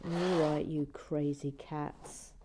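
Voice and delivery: usual speaking voice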